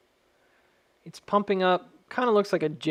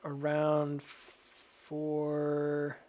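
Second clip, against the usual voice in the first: drawn out; 5 dB quieter, much slower than usual. Real, not acted. Drawn out hesitation.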